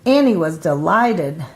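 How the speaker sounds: ordinary delivery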